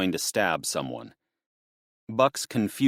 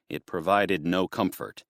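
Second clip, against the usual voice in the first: ordinary delivery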